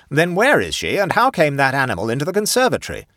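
loud, high indignant questioning; demanding. A narrator's reading. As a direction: demand answers like an angry colonel. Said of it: "demanded the Colonel".

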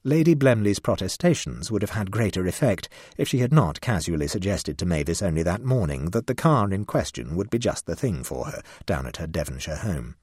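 ordinary delivery

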